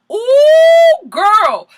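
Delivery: high, loud, long-drawn whoop; thrilled reaction. Retold or imitated. Vlogger reacting to Ariana taking on Stassi, thrilled. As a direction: whoop it like a delighted onlooker.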